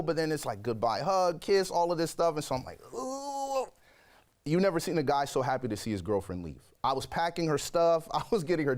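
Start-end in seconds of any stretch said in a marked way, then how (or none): 2.9-3.7 high, soft tempted ooh; a hushed high ooh of temptation. Retold or imitated. Tempted guy watching girlfriend leave, soft high ooh.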